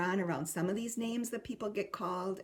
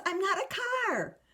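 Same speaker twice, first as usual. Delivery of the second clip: put-on much higher protesting child voice; indignant child; 11 semitones higher, 3 dB louder than usual. Child protesting the parent's joke, much higher and indignant. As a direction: insist like an offended little kid. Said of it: "eventually the child says".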